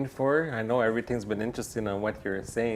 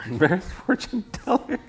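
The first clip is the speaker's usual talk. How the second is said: laughing through the words, pitched up; 12 semitones higher than usual. Real, not acted. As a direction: break into giggles mid-phrase. Reacting to silly prompt.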